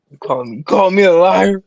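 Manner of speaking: loud, high, hammed-up indignation; a jokingly offended yell; mock outrage. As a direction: play up being offended, loudly. Mock outrage at being called liar.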